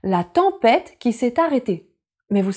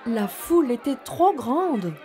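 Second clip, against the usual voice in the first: soft storytelling voice; 4 dB quieter than usual. A narrator's reading. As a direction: read gently to little children. Story narration begins.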